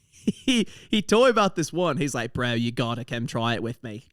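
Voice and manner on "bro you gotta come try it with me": lower-pitched Australian bloke; amateur Aussie impression, matey; Australian accent. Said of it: "I'm trying to do an Australian accent".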